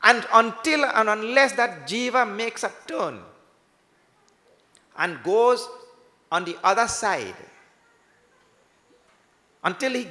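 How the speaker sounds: loud, high fervent sermon; preaching. Acted. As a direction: preach it with rising fervor. Preaching at full force.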